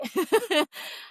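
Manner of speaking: high, breathy burst of laughter; relieved laugh. Real, not acted. Nurse laughing that she kept her job, high relieved laugh. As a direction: laugh off the scare.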